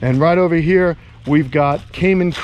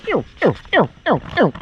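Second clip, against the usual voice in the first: quick high falling "ew" chirps; short chirped calls; 5 semitones higher, faster than usual. Shown as a demonstration. Man calling to his gators, high clipped chirps. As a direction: chirp it in short, evenly repeated bursts.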